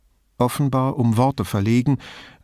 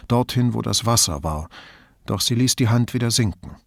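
plain reading voice

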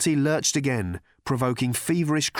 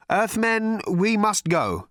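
high, slow anxious urging; nervous; 10 semitones higher, 4 dB louder, slower than usual. A narrator's reading. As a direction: urge them away nervously, pitched up. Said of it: "said Slarty Bartfast nervously".

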